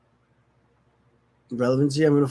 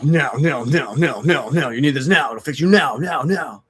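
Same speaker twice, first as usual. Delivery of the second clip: voice of the craving; 3 semitones higher, 4 dB louder than usual. Retold or imitated. The addiction voice in his head demanding a fix, insistent. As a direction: voice the addiction urging him on. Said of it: "blocked by this, like".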